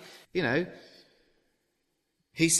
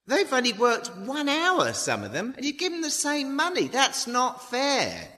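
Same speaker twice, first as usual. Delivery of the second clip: grumbling labourer; 11 semitones higher than usual. Acted. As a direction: sulk like a child cheated of pay. Grumbling vineyard labourers complain.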